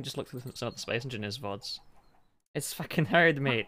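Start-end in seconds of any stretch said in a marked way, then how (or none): 2.5-3.7 raised, higher mock-Irish protest; [name]'s Irish voice. Quoting the annoyed friend for a laugh, raised Irish accent. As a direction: complain loudly in an Irish accent. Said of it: "he'll raise his voice".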